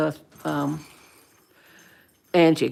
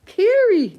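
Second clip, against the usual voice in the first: high, loud alarmed no to the pet; sudden panicked shout at the cat tearing paper; gasping, high-pitched alarm; alarmed cry; 6 dB louder, slower than usual. Real, not acted.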